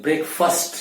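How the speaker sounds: ब्रेकफास्ट as brekfust, short middle; correct pronunciation. Shown as a demonstration. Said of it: "ब्रेकफास्ट नहीं". (ब्रेकफास्ट, ब्रेकफस्ट जैसा)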